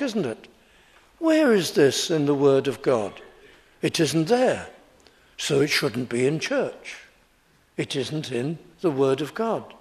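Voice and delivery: ordinary talk, nothing marked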